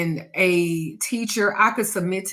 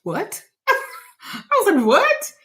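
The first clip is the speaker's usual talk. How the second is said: stunned what; 13 semitones higher, slower than usual. Retold or imitated. Retelling her reaction to his offer, a high incredulous what. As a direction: blurt it out in disbelief.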